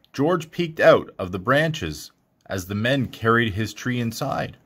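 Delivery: usual speaking voice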